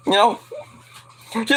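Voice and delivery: ordinary talk, nothing marked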